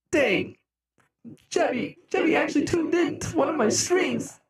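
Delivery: high-pitched impressed exclamation; retold amazement. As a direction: reenact your astonished reaction. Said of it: "And I was like".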